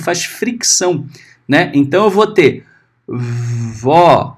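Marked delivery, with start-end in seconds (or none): 3.1-4.4 teacher demoing a fricative v. Shown as a demonstration. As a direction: breathe the vó out instead of popping it. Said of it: "você tem o sopro".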